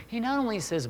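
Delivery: usual speaking voice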